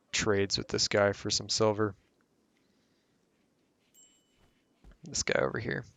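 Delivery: sore, hoarse throat; hoarse, tired voice. Real, not acted. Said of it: "I'm already losing my voice".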